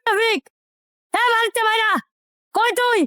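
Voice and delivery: high-pitched summoning shout; angry yelling. Acted. Angry neighbour calls Rafiq out of the house, yelling. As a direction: yell for him to come out. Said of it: "চিল্লাইতাছিস কি লিগা".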